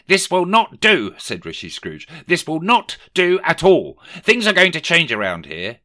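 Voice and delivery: high and loud reproach; stern Scrooge. A narrator's reading. Scrooge telling his clerk things will change, stern and loud. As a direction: lay down the law.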